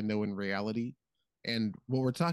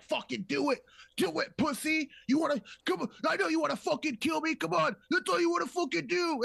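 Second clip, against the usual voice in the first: much higher, goading yell at Obi-Wan; mock taunting Anakin. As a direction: bait him into killing you. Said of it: "So he's like".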